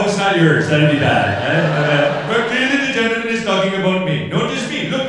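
ordinary talk, nothing marked

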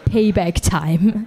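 slow, ominous mock movie line; dramatic punchline. A hint of a revenge-movie line. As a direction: deliver it like a villain's slogan. Punchline after booking laundry herself.

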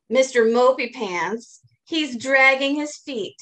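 usual speaking voice

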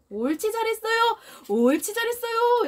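high, bright praise for a dog; high gushing good-dog praise; storm of praise. Shown as a demonstration. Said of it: "이렇게 폭풍 칭찬을 해줘야 합니다".

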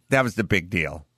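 ordinary talk, nothing marked

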